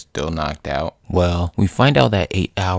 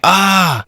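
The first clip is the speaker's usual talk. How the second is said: loud, high drawn-out cry; mock scream of pain; 12 semitones higher, 6 dB louder than usual. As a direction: scream as if bitten. Thor bitten in the hand.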